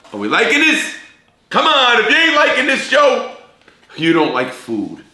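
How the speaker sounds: high, loud pumped-up hype; rally the audience like a showman; fired-up host pitch. Real, not acted. Hyping his cooking show to viewers.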